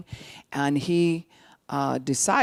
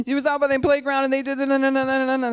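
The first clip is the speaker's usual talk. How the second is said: put-on high, loud, rushed child's tattle; arguing grandchild; 11 semitones higher, 6 dB louder, faster than usual. Grandkid reporting trouble at the playground, rushed child voice. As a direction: gabble it in a kid's high voice. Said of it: "if the grandkids are arguing".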